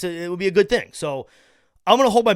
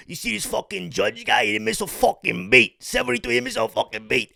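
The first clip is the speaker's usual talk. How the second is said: a light, higher deli worker voice on the phone; deli worker impression; 6 semitones higher than usual. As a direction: lightly imitate a union guy in the deli. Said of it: "he's going".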